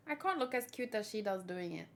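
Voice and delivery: ordinary talk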